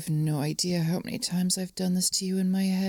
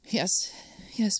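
ordinary talk

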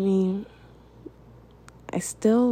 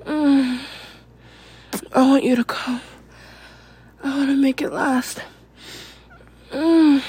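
higher, breathless, moaning delivery; breathy and urgent, broken by gasps and long pauses; breathless arousal; 5 semitones higher than usual.

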